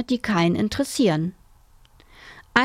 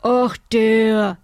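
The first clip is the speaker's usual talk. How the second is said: loud uncle with his mouth full; 5 dB louder than usual. A narrator's reading. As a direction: say it round a bite of cake. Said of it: "sagte Onkel Berthold mit vollem Mund".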